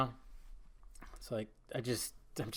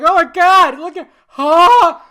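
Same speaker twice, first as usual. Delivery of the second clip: much higher, much louder shocked fan; mock gasping fan; 13 semitones higher, 17 dB louder, slower than usual. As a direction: squeal like a starstruck fan. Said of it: "Everybody's like".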